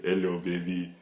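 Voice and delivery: loud put-on African accent; mock African accent, slowing at the end; hammed-up African accent. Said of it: "Don't do an Indian voice or an African".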